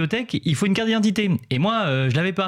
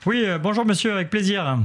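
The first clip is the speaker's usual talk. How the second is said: higher-pitched voice for a lady librarian; lightly mimicked, higher female role; imitating the librarian; 5 semitones higher than usual. Librarian greeting Benoît as he asks for a card, imitating her. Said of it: "elle m'a dit".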